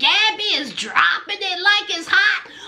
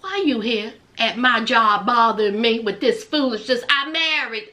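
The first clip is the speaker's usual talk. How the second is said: mock annoyed mother; 8 semitones lower than usual. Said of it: "Here is her mother".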